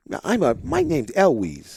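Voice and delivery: high-pitched imitation of a young daughter; little kid voice. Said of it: "like, little kid voices".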